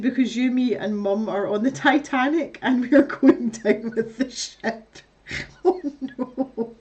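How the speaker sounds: reading through laughter; laughing. Real, not acted. Reading a grim chat message, laughing. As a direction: let the laugh break the words.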